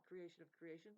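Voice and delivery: normal talking voice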